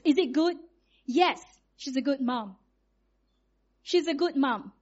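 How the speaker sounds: usual speaking voice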